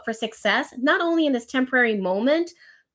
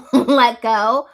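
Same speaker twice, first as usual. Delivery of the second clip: laughing; 8 dB louder than usual. Real, not acted. Laughing at the cliche.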